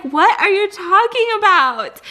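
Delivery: mock-confused group reply; baffled family quote. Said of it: "And they're all like".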